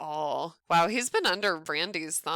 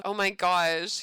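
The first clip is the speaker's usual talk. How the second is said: ordinary delivery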